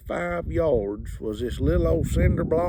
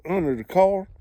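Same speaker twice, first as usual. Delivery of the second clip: normal talking voice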